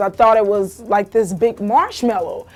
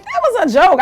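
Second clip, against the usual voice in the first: loud, high retold protest; defensive protest; 9 semitones higher, 5 dB louder than usual. Daughter defending her joke to mother, protesting. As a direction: push back in a high, loud voice.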